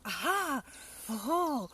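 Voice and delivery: Aha, she's found something; surprised discovery. Acted. Gruffalo's child spots a tail in the snow, surprised.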